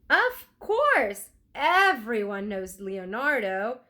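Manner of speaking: put-on a bright flower-selling girl who knows everyone. Flower girl answers Annie.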